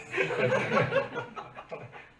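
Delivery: high breathless laugh at the absurdity; real helpless laugh. Real, not acted. Laughs at sales pitch.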